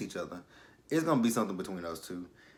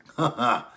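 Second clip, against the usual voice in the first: short laugh; 7 dB louder than usual. Real, not acted. Laughing at the scene.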